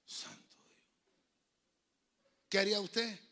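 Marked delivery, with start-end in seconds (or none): 0.1-0.8 breathless awed whisper; whispered shock. Retold or imitated. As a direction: whisper it in disbelief. Reacción al robo recordado. (susurro de asombro)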